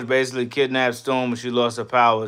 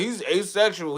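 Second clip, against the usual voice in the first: higher, soft, put-on voice; mock filmmakers; 7 semitones higher, 5 dB quieter than usual. Streamer mocking the filmmakers, soft put-on voice. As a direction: say it like a lame excuse. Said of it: "They was like".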